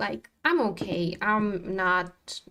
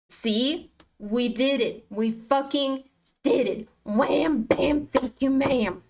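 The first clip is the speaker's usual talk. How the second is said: triumphant celebration; 4 semitones higher, 3 dB louder, slower than usual. Real, not acted. Celebrating a winning trade.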